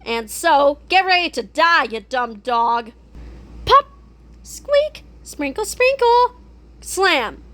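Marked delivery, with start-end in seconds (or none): none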